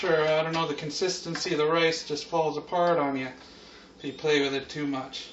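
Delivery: ordinary talk, nothing marked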